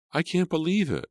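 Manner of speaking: high shocked exclamation; exclaiming. Acted. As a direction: burst out in disbelief. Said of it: "Melissa exclaimed".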